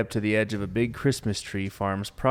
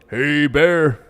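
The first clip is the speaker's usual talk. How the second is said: loud, higher-pitched call to a bear; yell; 5 semitones higher, 8 dB louder than usual. Acted. Camper outside the tent yelling at a bear, loud shout.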